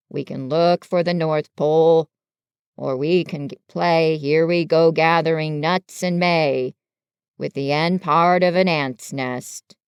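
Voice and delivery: low, droning donkey voice; Eeyore, glum resignation. Acted. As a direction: grumble on in a low, resigned drone. Reader voices gloomy Eeyore.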